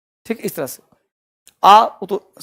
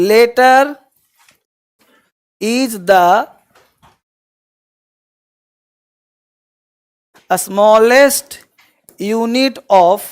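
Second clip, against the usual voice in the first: word by word with long pauses; dictation; much slower than usual. Shown as a demonstration. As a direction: dictate slowly for students to write down, leaving gaps. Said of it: "लिख लो". (लिखवाने के लिए रुक-रुक कर बोलना)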